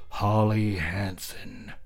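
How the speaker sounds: slow, grim, formal address; a chilling formal warning; boyfriend, slow and stern. Acted. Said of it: "spoke, spine-tingling grim".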